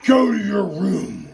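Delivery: slow, booming order; loud, high furious command; angry dad. Acted. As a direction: banish a child with a drawn-out shout. Dad angrily sends son away.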